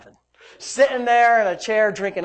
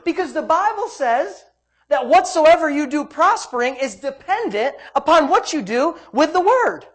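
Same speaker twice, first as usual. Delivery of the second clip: loud, raised preacher's delivery; forceful preaching; 7 semitones higher, 6 dB louder than usual. Real, not acted. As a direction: drive it home to the congregation.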